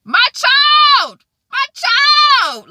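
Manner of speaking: high, loud imitated panic; frantic mama. Mother at a fair with lost children, loud mock wail. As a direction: wail it like a mother who lost her kid. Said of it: "like a mama".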